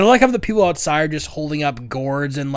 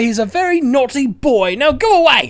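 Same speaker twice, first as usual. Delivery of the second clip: higher, louder mock scold; scolding mother; 9 semitones higher, 4 dB louder than usual. Brian's mother scolding the crowd, in a high mock scold. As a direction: shoo them like a cross mum.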